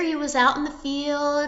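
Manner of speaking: plain reading voice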